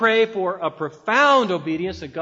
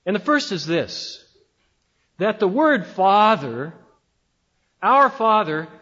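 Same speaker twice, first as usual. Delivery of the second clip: ordinary talk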